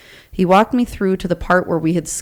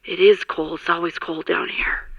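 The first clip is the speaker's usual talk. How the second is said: muffled voice. Retold or imitated. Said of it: "this muffled voice that says".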